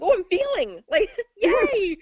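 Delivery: giddy high-pitched squeal with a laugh; loud, high, laughing cheer; excited. Retold or imitated. Healer recalls finally feeling the heat, giddily excited. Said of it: "I was obviously excited".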